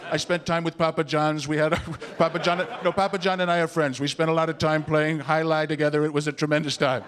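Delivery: level, self-satisfied name-dropping; boastful politician impression. Candidate claiming friendship with everybody, boastful impression. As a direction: brag steadily about a famous friend. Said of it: "I'm not the only one saying this".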